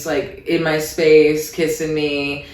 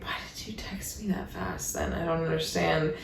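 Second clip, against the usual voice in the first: whispering under her breath, confused; whispered puzzlement; 15 dB quieter than usual. Retold or imitated. Wondering to herself why he texted so early, whispering. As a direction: hush it like a private thought.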